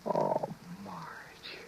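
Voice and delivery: soft whispered sigh of her name; whispered tenderly. Acted. Husband whispers his wife's name in relief.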